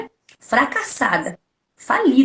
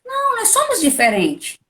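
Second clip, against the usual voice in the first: emphatic high no; 11 semitones higher than usual. Real, not acted. Rejecting copying others.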